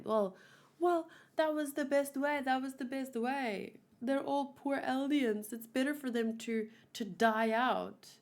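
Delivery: high, put-on whiny voice, slowing at the end; mocking Zeke supporter. Vlogger mocking Zeke's fans, in a high sneering voice. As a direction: parody the viewers who justify it, high and sneering. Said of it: "choose Zeke's side".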